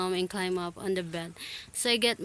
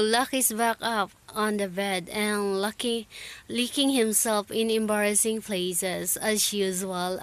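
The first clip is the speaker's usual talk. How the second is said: ordinary delivery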